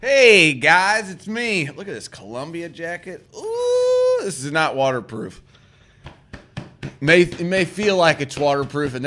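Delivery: loud, high goofy greeting; goofy hype voice. Acted. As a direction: burst in like a hyped host. Loud goofy vlog opener.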